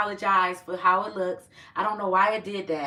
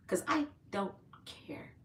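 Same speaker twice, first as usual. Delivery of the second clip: whisper; 10 dB quieter than usual. Real, not acted. Whispered aside in a livestream.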